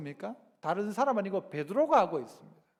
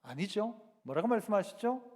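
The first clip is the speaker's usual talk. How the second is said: usual speaking voice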